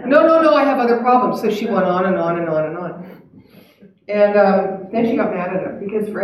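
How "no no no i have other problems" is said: loud, higher insistent objection; comic flustered insistence; mock protest. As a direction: protest urgently as the retold patient. Patient protests in bit.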